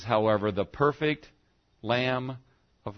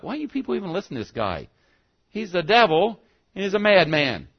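scoffing scribes; 6 semitones higher, 4 dB louder than usual. Acted. Scribes mocking Jesus.

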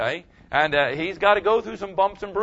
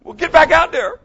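loud coaching; 10 semitones higher, 9 dB louder than usual. Retold or imitated.

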